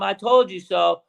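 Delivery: high, drawn-out mild yell; half-scream a slow, high victory boast; previewing a triumphant scream; mock gloating scream. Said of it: "I'm gonna scream".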